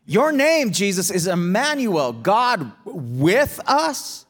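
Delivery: baffled pushback, pitch jumping up; disciples' protest. Acted. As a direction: protest in disbelief, drawing out the last words. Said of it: "And they're thinking".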